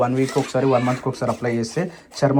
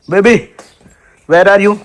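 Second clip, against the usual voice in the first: loud, raised-pitch call to find someone; 6 semitones higher, 10 dB louder, much slower than usual. Real, not acted. Calling out to find someone outdoors.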